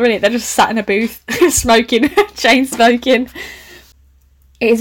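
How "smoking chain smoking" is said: laughing through the words. Real, not acted. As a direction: giggle out chain smoking, slowly. Laughing while reading.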